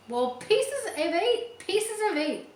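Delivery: very high, slow parrot squawk. Acted. The parrot screams at Jim, squawking high. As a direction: screech it like a parrot. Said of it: "the bird screamed".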